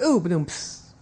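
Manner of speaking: mouthed drum sting after a joke; punchline drum hit done by mouth; vocal rimshot. Pretend. Co-host tags own priest joke, vocal rimshot.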